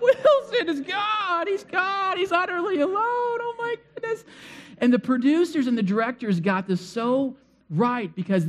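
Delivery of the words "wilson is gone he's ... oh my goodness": mock tearful wailing. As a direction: blubber like an overcome movie viewer. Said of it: "I'm, like, tearing up".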